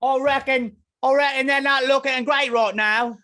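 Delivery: high, loud hammed-up British grumble; mock British accent. Complaining about Mitrovic in a mock British accent. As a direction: grouse like a gutted English fan.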